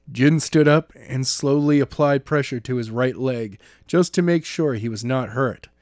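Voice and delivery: usual speaking voice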